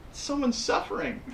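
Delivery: high, soft mock concern; high, hushed reproach; reproachful husband. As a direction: plead softly on a sufferer's behalf. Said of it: "I'm like".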